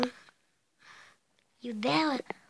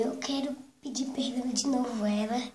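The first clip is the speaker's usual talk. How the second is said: usual speaking voice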